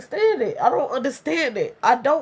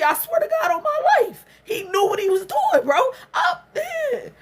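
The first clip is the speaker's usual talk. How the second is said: crying; 10 semitones higher than usual. Real, not acted. Crying while venting.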